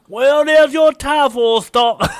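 very high, loud, drawn-out Cockney; hammed-up Cockney accent. Co-host showing off his Cockney take on Harrison, hammy Cockney. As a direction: belt it out in a mock Cockney accent. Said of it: "I could do my Cockney one".

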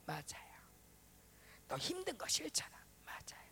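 near-whispered admissions and replies; imitated soft, breath-led exchange; hushed inner dialogue. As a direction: murmur meekly between God and self.

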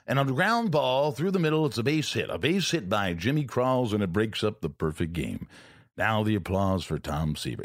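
lilting radio call, easing down at the end; Bob Murphy impression. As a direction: announce it like an old-time ballgame broadcaster. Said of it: "He just had that".

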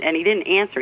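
ordinary talk, nothing marked

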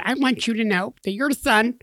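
high-pitched mock of a cross wife; imitating upset wife. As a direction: mimic an indignant mother up high. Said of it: "now that's how she talks".

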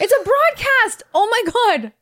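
light-bulb moment, high-pitched and loud; sudden realization. Real, not acted. Realizing the podcast name on air, high excited. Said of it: "That just dawned on me".

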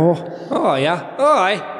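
slow, warming into an Irish accent; slight Irish accent. Shown as a demonstration. Co-host finding the voice for the bit, slight Irish accent. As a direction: try out a light Northern Irish accent. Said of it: "Give me a slight Irish accent".

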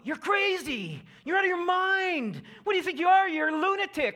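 high, loud jeering yells at Paul; mocking accusing crowd. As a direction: shout him down as a madman. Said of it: "Paul is just getting called out".

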